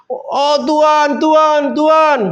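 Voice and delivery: drawn-out, high-pitched mock cry; imitated crying out. Preacher imitates people crying out to Tuhan, drawn-out high call. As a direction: call out high and slow, like people hailing the Lord. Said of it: "mereka berteriak".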